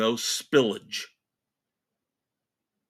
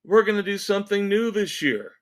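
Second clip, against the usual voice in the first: higher, mock-announcing voice; mocking institution voice; 8 semitones higher, 3 dB louder than usual. Host mimicking the Hall of Fame's announcement, mockingly. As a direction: imitate a self-pleased organization, pitched up. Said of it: "and they say".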